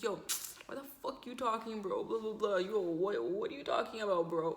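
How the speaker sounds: light imitation of Toronto slang talk; Toronto accent. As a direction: mimic a Toronto guy arguing, lightly. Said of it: "he's talking like a Toronto accent".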